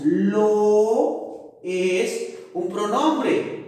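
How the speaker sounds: "lo" stretched out long and slow; slow emphasis on lo. Shown as a demonstration. Teacher to class, drawing out "lo" slowly. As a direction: linger on "lo" so the class hears it.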